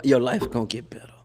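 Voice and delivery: whispered promise. Real, not acted. Whispering a promise to the stepfather. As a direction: lean in and whisper it.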